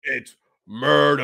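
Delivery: slow drawn-out murder; half-hearted rap-hook murder. Retold or imitated. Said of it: "do a [name] impression".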